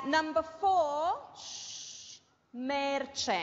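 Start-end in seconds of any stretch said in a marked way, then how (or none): 1.4-2.2 very soft, long "shh"; shushing. Real, not acted. Teacher between dictation items, a soft shush. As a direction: quietly hush the room.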